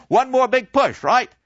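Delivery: high, loud, rousing cheer; rally the table, loud and high; hearty old man. Acted. Patriotic old man cheers push.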